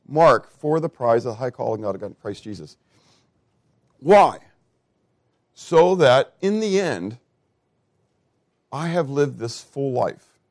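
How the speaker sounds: ordinary delivery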